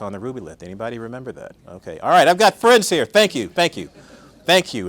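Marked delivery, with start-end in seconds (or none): 2.0-4.9 loud, high, delighted thank-yous; excited thanks. Real, not acted. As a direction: cheer the friends in the crowd. Audience responds, she cheers.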